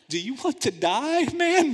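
high, loud incredulous warning; alarmed friend. Acted. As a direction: yell it like a scared friend. Friends step away, warning.

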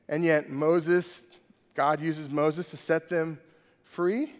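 ordinary talk, nothing marked

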